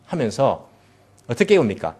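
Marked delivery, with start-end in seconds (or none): none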